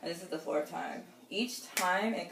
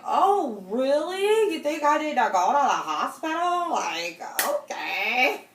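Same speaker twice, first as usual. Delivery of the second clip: loud, much higher mock-outraged sarcasm; sarcastic outburst; 8 semitones higher, 9 dB louder, slower than usual. Woman mocking her slow boyfriend, loud sarcasm. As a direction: throw it back at him, mocking and loud.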